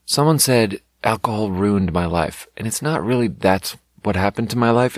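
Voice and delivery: ordinary talk, nothing marked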